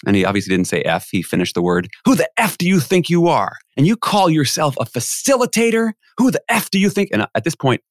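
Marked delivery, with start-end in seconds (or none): 2.0-7.1 high, indignant retelling of a tirade; mock angry yell. Angry guest challenging the facilitator, imitated yelling. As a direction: imitate a man cursing someone out, pitched high. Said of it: "he starts yelling at me".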